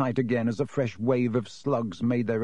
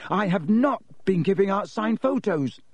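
hot denial; 8 semitones higher than usual. A narrator's reading. Harry hotly denying signed photos to Hagrid. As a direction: hotly insist "not". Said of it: "he said hotly".

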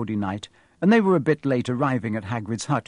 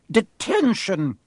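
slow, higher barked order; shouted; 7 semitones higher, slower than usual. Acted. McGonagall catching Malfoy at night, slow shout. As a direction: bark the word like a stern teacher. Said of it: "she shouted".

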